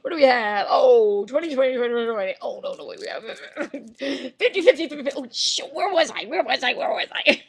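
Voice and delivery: loud, showy count of the bills; a hammed-up dealer counting cash; mock Vegas dealer. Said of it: "I'm like a dealer in Vegas".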